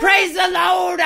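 high shouted preacher cry. Acted. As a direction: belt it out like a revival preacher. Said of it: "Do it in your preacher voice".

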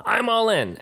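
high-pitched mock poker announcement; young player's announcement. Younger guy shoving all in at the table, high imitation. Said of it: "He shoved all in".

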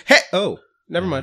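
indignant start, quickly deflating; startled protest fading to retreat. Acted. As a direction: protest sharply, then think better of it. Bar patron protests, then backs down.

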